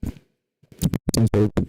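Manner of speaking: muffled, booming too-close microphone sound; mouth on the mic. Real, not acted. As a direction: press your mouth to the mic and talk. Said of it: "I can put my mouth on this thing".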